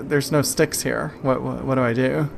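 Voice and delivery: ordinary talk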